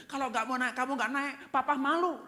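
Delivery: high, loud reproach; self-centred parental scolding. Shown as a demonstration. Father shaming child over school, high loud scolding. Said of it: "Jangan berkata begini".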